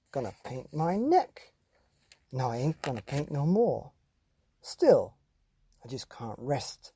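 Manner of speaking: lively, sing-song picture book reading; animated storytime voice with a singsong refrain; reading to children. Acted. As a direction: read it like a bouncy bedtime rhyme to kids. Reads a picture book aloud.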